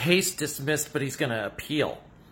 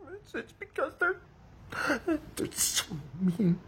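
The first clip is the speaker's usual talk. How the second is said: high, softer voice choked by sobs; sobbing; 8 semitones higher, 7 dB quieter than usual. Acted. Parody fan blames mean critics, choked sobbing. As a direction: blubber it out through tears. Breaks down crying over critics.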